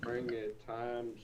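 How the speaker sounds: faint, whispered, far from mic; almost inaudible unvoiced murmur; barely audible. Real, not acted. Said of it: "but I can barely hear you".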